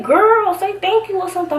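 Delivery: high, pushy aside to a friend; girl, come on, say it; exasperated nudge. Retold or imitated. Urging her silent friend to thank Tanya, high exasperated. Nudging Natalie to respond.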